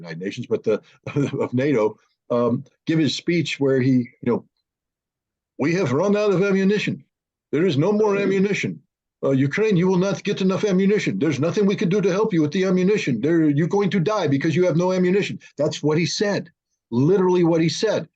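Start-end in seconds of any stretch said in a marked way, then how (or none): 5.6-15.4 raised, lightly voiced doom-saying official; hamming up a hopeless announcement; mock gloomy official. Mocking the NATO chief telling Ukraine there's no ammunition, hammed-up doom.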